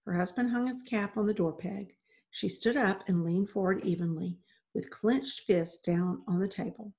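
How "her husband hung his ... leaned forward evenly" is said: ordinary delivery, nothing marked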